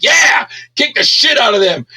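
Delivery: high, loud cheer; watching RoboCop strike back, cheering loudly. Retold or imitated. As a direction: egg the hero on.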